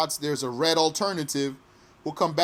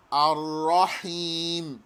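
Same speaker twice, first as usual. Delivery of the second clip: usual speaking voice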